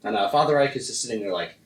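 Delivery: normal talking voice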